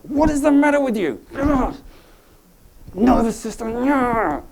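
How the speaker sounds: high, exasperated mock scolding; annoyed with oneself. Imitating people with pain annoyed at themselves, high exasperated scolding. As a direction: scold yourself in a loud, high, irritated squawk. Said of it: "people get quite annoyed with themselves".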